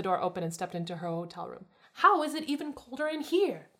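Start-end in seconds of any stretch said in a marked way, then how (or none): 1.9-3.7 exasperated complaint. A narrator's reading. Daphne complains entering cold room.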